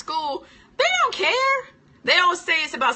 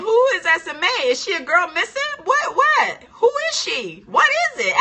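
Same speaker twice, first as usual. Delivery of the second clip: high, loud, puzzled questions; baffled questioning; 9 semitones higher, 6 dB louder than usual. Retold or imitated. Student baffled by the teacher's accent, high loud questions. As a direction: ask high and loud like you truly don't get it. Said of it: "I kept saying".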